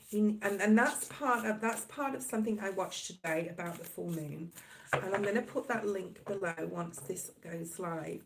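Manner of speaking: ordinary talk, nothing marked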